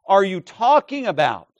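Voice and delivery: slow, loud, incredulous exclamation; astonished shout. Acted. Jesus marveling at their ignorance, astonished shout. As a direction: cry it out in disbelief. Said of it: "he's shouting, he's exclaiming".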